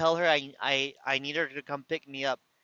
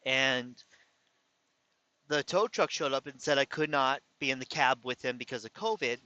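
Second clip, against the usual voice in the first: ordinary talk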